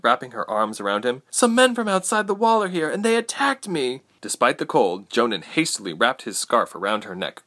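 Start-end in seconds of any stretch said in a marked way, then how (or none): 1.3-4.0 very high, distressed daughter's voice; Ilse, alarmed. A narrator's reading. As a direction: tell your father of the danger, high-voiced. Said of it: "Ilse cried".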